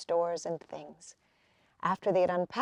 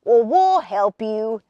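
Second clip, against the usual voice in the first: louder, slow friendly call; the boys calling from the bank, louder and slow; eager shout; 3 semitones higher, 8 dB louder than usual. A narrator's reading. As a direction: offer help with a hearty shout. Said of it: "shouted the boys on the bank".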